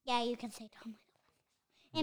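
hushed whisper about a dark name. Real, not acted. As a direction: whisper it like a secret. Whispering forbidden names.

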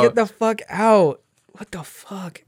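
high, slowed mock yell; hammed-up outraged shout; mock outrage. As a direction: drag it out in fake fury.

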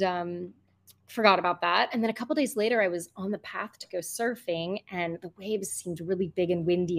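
ordinary talk, nothing marked

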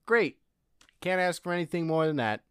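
sarcastic great. Real, not acted. Sarcastic reaction to news.

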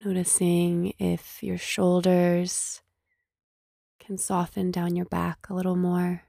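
slow meditation voice with long pauses; guided meditation. Acted. Host leading listeners to scan body and breath, guided meditation. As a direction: gently guide listeners into stillness, unhurried.

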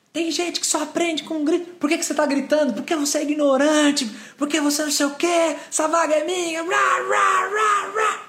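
very high, strained mock screaming; retold angry yelling. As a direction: climb into a hammed-up yelling rant. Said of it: "eu comecei a gritar com ela". (berrando bravo, em imitação exagerada)

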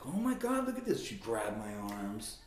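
high, gushing mock exclamation; excited admiring aunt. Aunt gushing over her shirtless nephew, excited. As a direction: imitate an aunt fawning over muscles.

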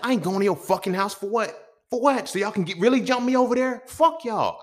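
loud, high screaming refusal; furious yelling. Retold or imitated. Refusing to go to the lady's house, furious yell. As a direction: yell it, pitch cracking up high. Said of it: "I said".

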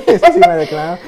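real laughter breaking into the line; laughing. Real, not acted. Laughing with his co-host about a friend. Laughing while recalling Wilfredo.

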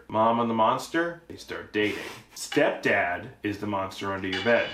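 ordinary delivery

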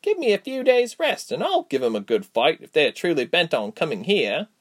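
lightly voiced plucky friend; Reshma, willing to fight.

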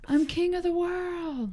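high, slow triumphant yell; triumphant shout, hammed up. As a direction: cry it out from the ship's bow. Movie quote game, Titanic.

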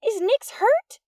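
high, loud, slow call through the rain; a girl calling out loud over the rain; shouting over rain. A narrator's reading. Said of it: "Amy shouted over the patter of rain".

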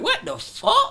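high, amazed what the fuck; gawking at the wild crowd; stunned disbelief. Retold or imitated. Rapper stunned by the crowd, high amazed disbelief.

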